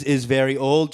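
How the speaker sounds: ordinary talk, nothing marked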